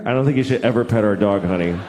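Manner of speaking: a hint of a worried mom; mock stern mom. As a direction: caution the little one, half-joking. Mother warning daughter gently.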